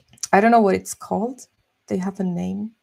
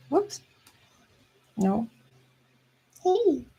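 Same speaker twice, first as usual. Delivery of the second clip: cat voice; 7 semitones higher, slower than usual. Real, not acted. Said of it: "That's my cat voice".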